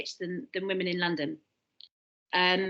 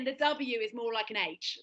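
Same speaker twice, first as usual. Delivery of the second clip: ordinary delivery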